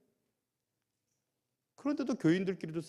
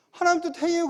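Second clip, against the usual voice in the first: mock claim; 11 semitones higher, 6 dB louder, faster than usual. Mimicking believer's claim.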